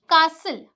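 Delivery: "कासल" firmly, s straight into l; silent T. Shown as a demonstration. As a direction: say "कासल" as kaa-sul. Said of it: "अब इसे कास्टल नहीं बोलते हैं".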